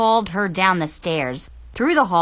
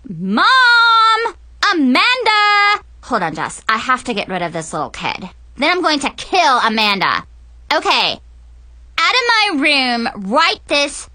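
put-on bratty teen yelling; 6 semitones higher, 6 dB louder than usual. Mackenzie yelling for family.

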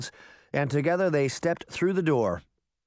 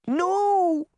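put-on much higher, louder child's cry; shouted no; 5 dB louder than usual. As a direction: Daphne refusing the witch. Daphne refuses the bucket.

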